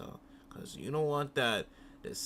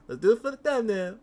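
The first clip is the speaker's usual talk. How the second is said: higher, louder mocking coax; mock mom, louder; 9 semitones higher, 9 dB louder than usual. Mocking the mom pushing her crying son for a thumbnail, loud. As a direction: ham up the mom pushing for the shot. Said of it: "He said".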